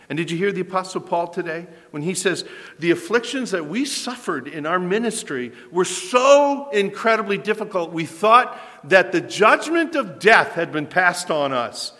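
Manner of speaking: high, loud, building fervent preaching; full-force preaching. Acted. Preacher rallies the congregation that God raises the dead, full-force preaching. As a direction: preach it to the back row.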